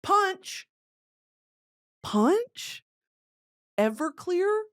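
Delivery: high, drawn-out questioning calls; mock a frat guy pushing the punch; frat boy offering punch. Frat guy offering spiked punch at a party, hammed-up high calls. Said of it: "they said".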